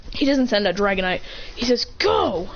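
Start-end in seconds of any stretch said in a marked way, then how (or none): 2.0-2.5 high-pitched trainer's call; battle command. Acted. Trainer sending out his Pokémon, excited battle call. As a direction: call it out to send in a fighter.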